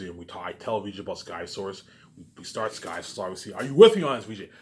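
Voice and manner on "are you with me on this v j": louder, higher, fired-up question; rally her into the plan; excited pitch. Retold or imitated. Pitching his plan to his wife.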